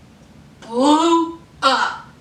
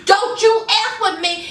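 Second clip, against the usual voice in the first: higher-pitched mock outburst; heated warning; 6 semitones higher than usual. Host as Nicki warning Joe, heated. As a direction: warn him off, indignant and high.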